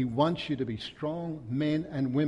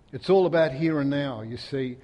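ordinary talk

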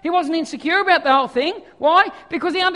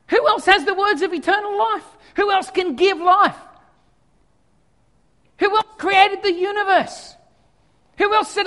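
high, raised voice, building to a cry; impassioned rhetorical questions; 6 semitones higher, 5 dB louder than usual. Acted. Disciples asking who else gives eternal life, impassioned appeal. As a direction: press each question harder, ending highest. Voicing the disciples' devoted reply.

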